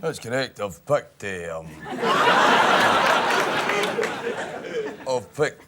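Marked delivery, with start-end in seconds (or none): 1.7-5.0 breathless high laugh; burst of laughter. Real, not acted. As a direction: crack up helplessly. Breaks up mid-answer.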